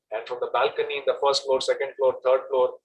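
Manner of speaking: ordinary talk, nothing marked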